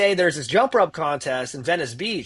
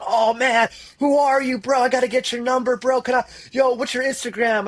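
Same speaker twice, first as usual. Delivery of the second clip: higher-pitched, eager gushing; hyped-up bro chatter, pitched up; starstruck fans; 6 semitones higher than usual. Retold or imitated. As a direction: imitate excited admirers crowding a winner. Admiring jump ropers crowd the winner.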